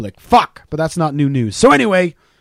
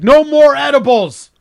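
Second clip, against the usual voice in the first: slow, high and loud declaration; telling his wife after waking up, mock yell; 10 semitones higher, 6 dB louder, slower than usual. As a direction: bellow it like a stoned vow. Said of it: "and I'm like".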